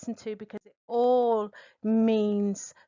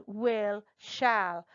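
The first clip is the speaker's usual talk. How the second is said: ordinary delivery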